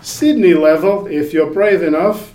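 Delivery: mock Australian accent. Server offering fire-themed spice levels, mock Australian accent. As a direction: stretch it out in a hammy Australian accent.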